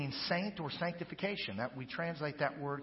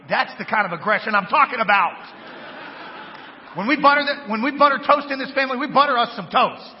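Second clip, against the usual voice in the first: hyped shout; 5 semitones higher, 13 dB louder than usual. Acted. Joking hype about buttering toast.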